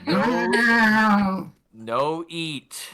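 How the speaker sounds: firm, high, drawn-out command; gentleman soothing cat. Acted. Gentleman warns the kitty off, high and drawn out. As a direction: forbid it as if to an animal. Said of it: "you hear a gentleman go".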